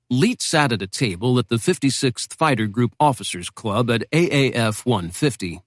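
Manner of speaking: ordinary delivery, nothing marked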